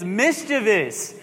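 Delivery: "mischievous" said slowly as "MIS-chuh-vus"; three syllables on "mischievous", stress first; correct mischievous. Shown as a demonstration. Pastor correcting the congregation, slow three-syllable pronunciation. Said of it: "this is how you say it".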